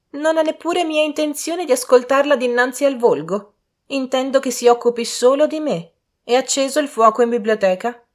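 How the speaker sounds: high, loud haughty lady; imperious. A narrator's reading. Lady giving servant orders, imperious high voice.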